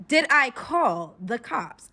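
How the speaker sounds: slow, loud, pointed question; indignant denial. Retold or imitated. Captive denying she called police, slow and indignant. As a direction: spell it out loudly, word by word.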